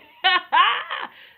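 loud, high burst of laughter. Real, not acted. Recapping the dusty read, bursting into laughter. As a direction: crack up at the insult.

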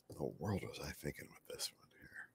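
hushed whisper to himself. Real, not acted. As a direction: whisper it under your breath. Painter muttering to himself.

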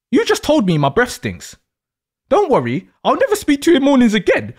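ordinary talk

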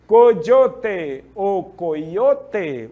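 Coyote said very slowly, first with a dj sound, then with a y sound; two ways of saying it. Shown as a demonstration. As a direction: drag out both versions of Coyote, dj first, then y. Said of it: "tem sons diferenciados".

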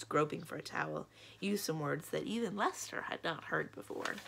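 plain reading voice

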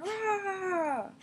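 a tiny fake monster growl; mock unicorn roar. Playing pretend, a high soft mock roar.

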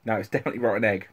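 talking around a bean; tasting while speaking; chewing while talking. Real, not acted. Seen 1: Woman grimaces, lips shift sideways as if chewing while talking.

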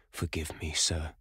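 hushed, whispery apology; subdued, near-whispered plea; muttered. A narrator's reading. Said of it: "muttered James Vane".

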